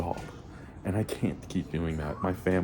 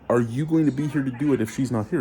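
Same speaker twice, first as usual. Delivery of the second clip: higher and louder pointed question; challenging retort; 6 semitones higher, 3 dB louder than usual. Retold or imitated. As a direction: press him with the question. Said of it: "I go".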